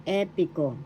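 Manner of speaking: E stressed in "epico"; stress on first syllable. Shown as a demonstration. Teacher demonstrating the accent, first E stressed in "epico". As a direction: lean on the opening E of "epico". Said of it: "is in the first syllable".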